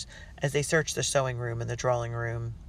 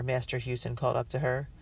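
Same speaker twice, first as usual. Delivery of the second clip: ordinary delivery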